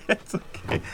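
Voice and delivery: breathy laugh through the words. Real, not acted. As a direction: laugh it out softly.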